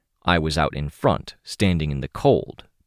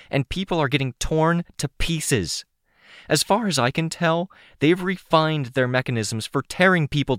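raised, high, heated pleading; angry demand; 10 semitones higher than usual. A narrator's reading. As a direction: push hard, almost shouting. Demands help, angry at Citrine.

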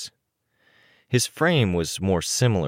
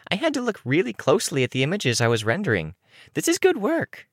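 very high, fast protest; high child character voice; 13 semitones higher, faster than usual. A narrator's reading. Defending the images she rendered, high-pitched and fast. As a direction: pipe up quickly, climbing higher at the end. Looksee keeps defending her projection.

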